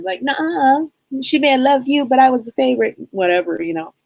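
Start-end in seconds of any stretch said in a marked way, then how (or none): 0.2-3.0 playful mock contradiction, higher voice; bickering sister. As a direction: imitate a sister squabbling over who's favorite. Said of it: "Me and my sister do that all the time".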